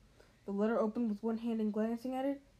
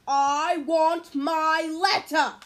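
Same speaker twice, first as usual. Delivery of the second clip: very loud slow demand; angry shout; 6 semitones higher, 14 dB louder, much slower than usual. A narrator's reading. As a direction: bellow it, furious boy. Harry insisting loudly.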